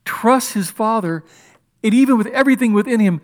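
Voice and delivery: ordinary delivery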